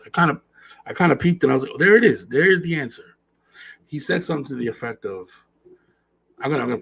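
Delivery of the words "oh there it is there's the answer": higher, a touch louder "oh, there it is"; catching the answer in the act; aha realization. Retold or imitated. Podcast host recalls his aha moment, mock realization.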